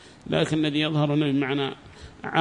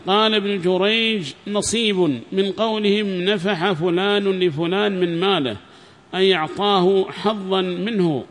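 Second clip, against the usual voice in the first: higher-pitched formal recitation of a book; formal lesson reading voice; reading a text aloud; 4 semitones higher, 5 dB louder, slower than usual. Acted. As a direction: read the passage out clearly to a class.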